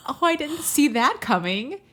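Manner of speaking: usual speaking voice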